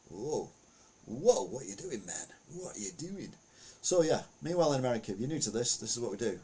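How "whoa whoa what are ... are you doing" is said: mock startled. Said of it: "that was a bit like".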